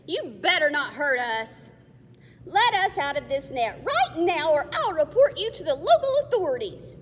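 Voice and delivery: loud, high, defiant threat. Acted. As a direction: stand up to them loudly. Girl threatens captors.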